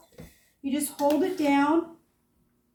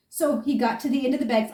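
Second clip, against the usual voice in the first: ordinary talk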